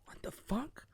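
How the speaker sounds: hushed mock disbelief; barely voiced, incredulous aside; baffled whisper. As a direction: whisper it in comic bewilderment. Reader's baffled reaction.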